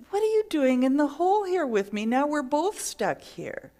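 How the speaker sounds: high, loud disbelief; surprised. Acted. Stuck man, shocked that his friend jumped in. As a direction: ask in alarm why he jumped in. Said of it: "the person says".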